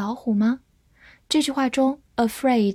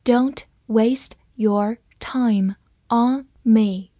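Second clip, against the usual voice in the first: each word set apart by a pause; slow reading; much slower than usual. Shown as a demonstration. Teacher models the sentence for learners to follow, slow reading. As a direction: read it slowly, word by word. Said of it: "慢慢来读".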